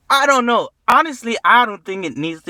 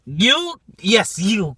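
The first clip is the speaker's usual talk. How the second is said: usual speaking voice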